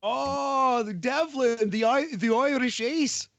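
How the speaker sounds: mock Irish brogue, clearly fake Irish; terrible Irish accent. Joking about the wrestler Devlin in a bad Irish accent. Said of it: "That's a terrible accent".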